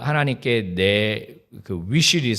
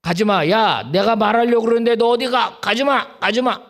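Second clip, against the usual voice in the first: higher, louder calling out "가지 마"; God calling back; 10 semitones higher, 7 dB louder than usual. Retold or imitated. God calling the departing worshipper back, urgent loud plea. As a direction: call after someone walking away, urgent. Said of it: "하나님이 그때".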